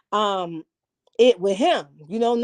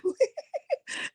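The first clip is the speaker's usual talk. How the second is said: soft laugh; 13 semitones higher, 11 dB quieter than usual. Real, not acted.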